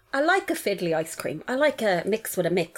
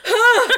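loud high strained grunt; imitated toilet grunt; 9 dB louder than usual. Describing the toilet to a friend, loud strained grunt. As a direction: blurt out the straining noise. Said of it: "Like that".

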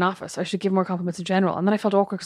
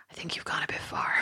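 whispered; 13 dB quieter than usual. Retold or imitated. Friend wishing for wedding comment.

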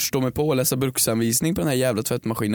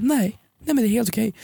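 mock sweet refusal; 8 semitones higher than usual. Acted person refusing gifts.

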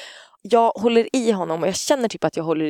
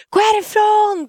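high, loud imitated scream; retold furious yell; 5 dB louder, slower than usual. Parent hitting partner's arm in a fight, imitated furious scream. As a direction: re-enact screaming someone away. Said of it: "typ, och bara".

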